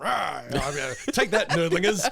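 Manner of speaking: ein Hauch von Kriegsgeneral, lauter gebellt; General Patton. Retold or imitated. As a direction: spiel kurz einen angriffslustigen General. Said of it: "like he's General Patton or something".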